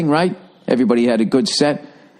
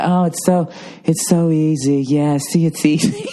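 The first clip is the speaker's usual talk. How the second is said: smug mother imitation; 2 semitones higher, slower than usual.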